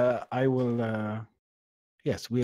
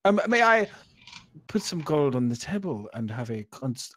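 lightly voiced Viking accent; 3 semitones higher, 6 dB louder than usual. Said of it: "accents is tough".

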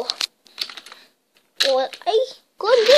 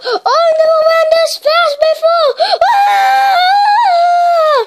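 much higher and louder, yelling with fright and glee; thrilled scream; 11 semitones higher, 7 dB louder, much slower than usual. Acted. Gordon flying down the hill, screaming wildly. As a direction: scream like you are riding a roller coaster.

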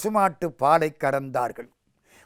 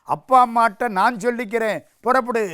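higher, louder big-brother order; firm dismissal; 6 semitones higher, 5 dB louder than usual. Acted. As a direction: send him off with authority. Elder brother sends him off.

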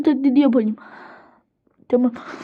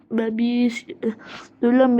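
ordinary talk

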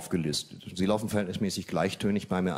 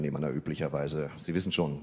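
normal talking voice

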